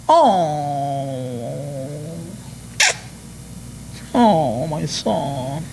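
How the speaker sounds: a drawn-out, lightly mocking tender "oh"; a softie father cooing over his son; mock doting father. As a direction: ham up the soft indulgent dad. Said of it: "Ahí está el peje".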